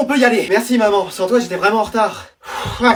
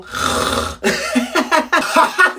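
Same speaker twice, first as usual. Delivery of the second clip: high mocking laugh; 7 semitones higher, 4 dB quieter than usual. Teacher laughs at imitation.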